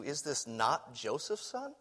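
soft, low wondering question, almost to yourself; murmured puzzled aside; hushed musing question. Retold or imitated. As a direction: quietly turn the question over. Pondering the townspeople's question.

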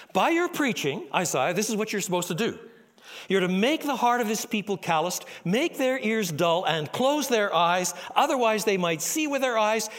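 loud, high, forceful decree; God's stern commission. Acted. God giving Isaiah his grim orders, loud and forceful. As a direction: lay down the hard charge like a judge.

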